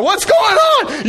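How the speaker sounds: high, loud, slow mock yell; hammed-up angry dad shout. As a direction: bellow it into the kids' room. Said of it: "and you're going".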